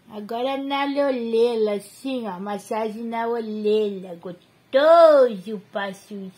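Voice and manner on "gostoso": gostoso lento e bem mais alto; gostoso esticado. Real, not acted. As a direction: estica a palavra, encantada. Massagem na orelha. (gostoso arrastado e bem alto)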